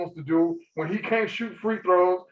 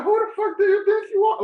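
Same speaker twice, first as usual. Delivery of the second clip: high, raised indignant shout; indignant outburst; 14 semitones higher, 3 dB louder than usual. Real, not acted. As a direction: call him out, fully worked up. Ranting at actor's arrogance.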